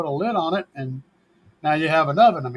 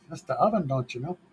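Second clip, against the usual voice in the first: softer mock-Canadian lilt, trailing off; Canadian accent; 6 dB quieter, faster than usual. Repeating the oven joke, lightly Canadian accent. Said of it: "That's my, my, uh, Canadian accent there".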